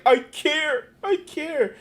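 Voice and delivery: high mock whine of a crying politician. Streamer mocking a politician crying over taxes, high mock whine. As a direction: whine it high like a fake-weeping official. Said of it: "who was just crying".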